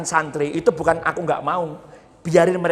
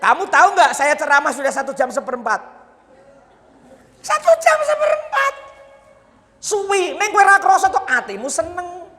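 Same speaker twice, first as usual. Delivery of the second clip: much higher, loud comic voice; mock-exasperated high voice; 14 semitones higher, 5 dB louder than usual. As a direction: tease the listener in a squeaky put-on voice. Jokingly scolds listener, high put-on voice.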